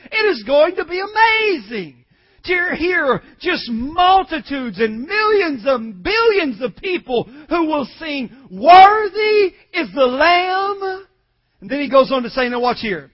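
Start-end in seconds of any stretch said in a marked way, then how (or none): 0.1-11.1 high, rising preacher's exultation; full-force preaching. Real, not acted. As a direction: build to a loud, long-held proclamation.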